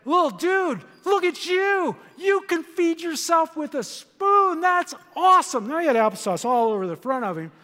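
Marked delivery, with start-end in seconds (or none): 0.0-5.7 put-on high, loud, beaming praise for a toddler; delighted grandpa. Grandpa cheering his grandson feeding himself, excited and high. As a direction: gush over the little one, much higher. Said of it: "I said".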